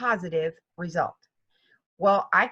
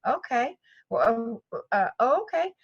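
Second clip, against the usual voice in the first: rising, unsure okay; mock surprise, lightly played; baffled; 3 semitones higher than usual. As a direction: caught off guard, fumbling. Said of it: "And I'm like".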